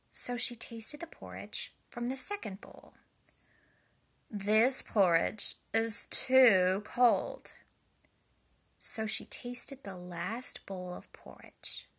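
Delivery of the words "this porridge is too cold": put-on loud, slow little-girl voice; Goldilocks complaining. A narrator's reading. Goldilocks rejecting cold porridge, loud slow complaint. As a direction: stretch out the disappointment. Goldilocks tastes the second bowl.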